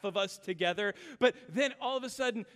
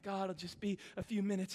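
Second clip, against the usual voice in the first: tired quiet plea; 3 semitones lower, 7 dB quieter, faster than usual. Retold or imitated. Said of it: "And I'm like".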